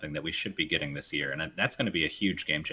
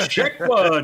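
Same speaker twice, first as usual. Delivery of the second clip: higher, louder hammed-up check; loud mock mic check; 13 semitones higher, 12 dB louder than usual. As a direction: yell it laughing.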